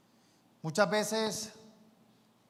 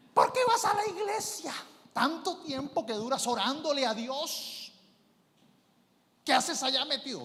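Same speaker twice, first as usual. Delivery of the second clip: high, loud nagging wife voice; imitating a wife nagging her churchgoing husband; mock scolding wife; 8 semitones higher, 4 dB louder than usual. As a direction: nag him about all that church time.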